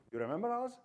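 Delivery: usual speaking voice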